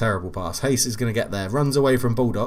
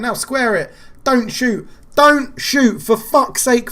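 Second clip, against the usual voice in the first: higher, louder yells at the screen; furious shouting; 11 semitones higher, 8 dB louder, slower than usual. Real, not acted. Streamer yelling at his winger not to shoot, furious. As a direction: bellow orders at a player who won't listen. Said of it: "I'm absolutely furious at the game".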